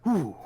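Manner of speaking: high, loud exhaled whew; relieved whew. Real, not acted. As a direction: let out a big falling whew. Relief after a long dice wait.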